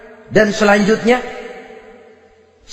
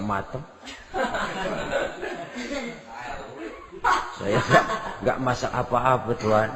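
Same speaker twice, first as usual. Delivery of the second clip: hushed night talk; 8 semitones lower, 12 dB quieter than usual. Acted. Quiet night talk by the pot.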